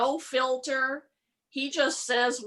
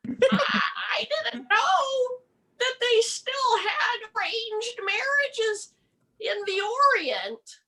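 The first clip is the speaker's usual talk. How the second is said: high, quavering, scandalised voice; shocked older woman; 9 semitones higher than usual. Retold or imitated. Comic playing the uncomfortable older woman, high and shocked. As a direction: clutch your pearls, much higher. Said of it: "She said".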